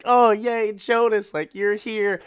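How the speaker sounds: mock gushing relief; delighted squeal. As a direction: squeal with joy at finding him. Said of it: "She's like".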